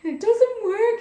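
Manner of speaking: sudden high, loud exasperated cry; frustrated outburst. Retold or imitated. Re-enacting her anger at the dead laptop, loud exasperated cry. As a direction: blurt it out in exasperation. Pissed, driving home, laptop broken.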